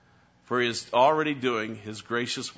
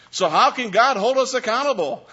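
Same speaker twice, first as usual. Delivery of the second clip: incredulous mock protest, pitched much higher and louder; mock objection; 11 semitones higher, 9 dB louder than usual. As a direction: play the indignant objector. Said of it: "join with the Roman church and say".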